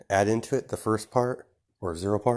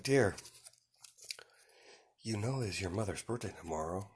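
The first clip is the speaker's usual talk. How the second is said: soft, hushed aside; whispering; 7 dB quieter than usual. A narrator's reading. Mother quietly tells son about the birthday, whispering. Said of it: "whispers in Midoriya's ear".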